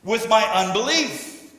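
loud, high-pitched shouted plea; desperate exclaiming cry. Acted. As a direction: cry out desperately to Jesus at full voice. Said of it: "exclaimed ... to scream, or to call aloud".

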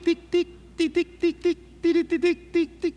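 high, quick tic tic tic like a telegraph key; light clicking tic sounds; imitated telegraph ticking. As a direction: tap out Morse code with the voice. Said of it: "Usaban tic".

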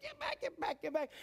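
high, hushed get back; bodyguard. Retold or imitated. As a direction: shoo the crowd like a bodyguard. Disciples as bodyguards.

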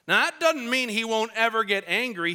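ordinary delivery

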